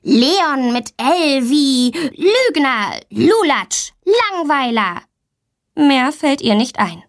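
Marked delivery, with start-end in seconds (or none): 0.0-5.0 slow, higher-pitched indignant taunts; angry name-calling. A narrator's reading. Teased little sister scolding her brother back, angry. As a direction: hurl each insult back, drawn out. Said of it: "wird immer richtig wütend".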